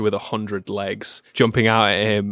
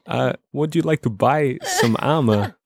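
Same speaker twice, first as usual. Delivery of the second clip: Christopher Walken shopkeeper; 2 semitones higher than usual. Acted. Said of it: "Christopher Walken N P C".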